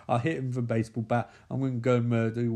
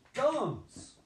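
yelling at owl; 9 semitones higher, 3 dB quieter than usual. Real, not acted.

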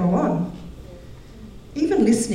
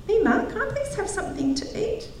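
put-on polite child voice; 12 semitones higher, 5 dB quieter than usual. Child asks nicely after whining.

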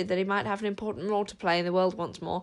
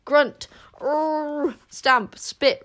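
higher, loud beastly grunts; urgent werewolf; 7 semitones higher, 6 dB louder, much slower than usual. Acted. Werewolf repeating his warning, urgently. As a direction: bark the words like a frantic werewolf. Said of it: "rather more urgently".

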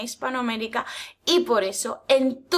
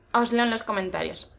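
ordinary talk, nothing marked

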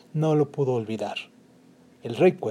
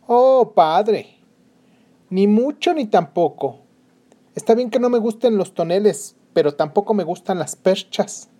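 mocking, higher and louder; haughty princess; 6 semitones higher, 5 dB louder than usual. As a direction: scoff at the skinny suitor. Princess mocks another suitor.

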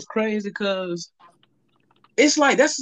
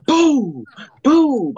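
bang; 8 semitones higher, 5 dB louder than usual. Retold or imitated. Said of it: "It goes".

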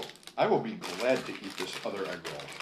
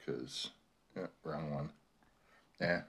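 ordinary delivery

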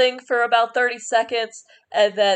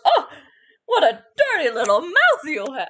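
put-on old woman amused scold; 6 semitones higher than usual. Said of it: "And she goes".

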